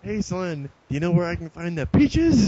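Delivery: Don Knotts. Retold or imitated. Man approaching a shopper in the produce aisle, lightly imitated. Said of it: "It was, like, Don Knotts".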